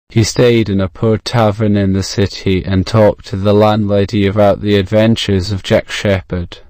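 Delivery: usual speaking voice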